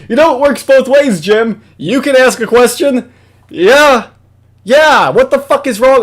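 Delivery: loud, high-pitched indignant rant; exasperated scolding. Real, not acted. Host telling Jim it works both ways, loud exasperation. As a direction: scold him, voice pushed up and loud.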